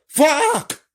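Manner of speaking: high pained scream; screaming in pain after being shot. A narrator's reading. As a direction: scream as if just shot in the leg.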